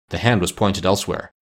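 ordinary delivery

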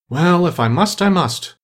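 usual speaking voice

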